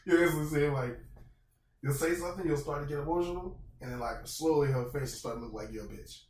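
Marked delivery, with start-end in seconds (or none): none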